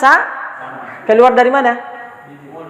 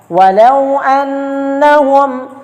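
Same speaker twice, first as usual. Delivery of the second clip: slow, drawn-out tilawah fragment; Quran recitation; 4 semitones higher, much slower than usual. Shown as a demonstration. Teacher recites the verse example slowly.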